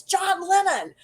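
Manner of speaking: high-pitched mock yell at no great volume; high, contained holler imitating a fan; imitated fan's yell. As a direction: call out like an excited girl down a hallway. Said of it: "and yells".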